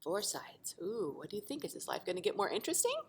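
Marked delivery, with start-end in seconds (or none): none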